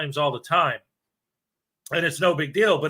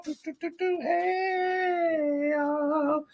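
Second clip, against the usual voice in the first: softer, cartoon-high humming and greeting; squeaky high voice; 12 semitones higher, 6 dB quieter, much slower than usual. Streamer playing around between greetings in squeaky voice. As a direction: keep the chipmunk pitch going, playful.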